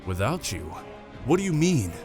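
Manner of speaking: taken aback. Acted. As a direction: question the commander in mild disbelief. Quartermaster learns commander stays behind.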